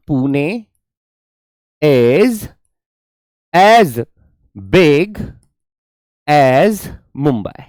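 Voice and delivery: very slow, long pauses between words; slow, paused dictation. Shown as a demonstration. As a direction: build the sentence one word at a time. Teacher building sentence word by word.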